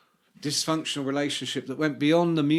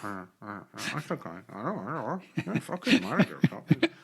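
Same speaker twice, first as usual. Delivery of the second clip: lower, hushed distracted phone voice; 5 semitones lower, faster than usual. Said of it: "was like".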